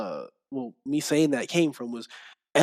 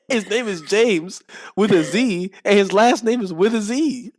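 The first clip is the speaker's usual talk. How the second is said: excited; 7 semitones higher, 7 dB louder than usual. Real, not acted. Excited retelling of discovery.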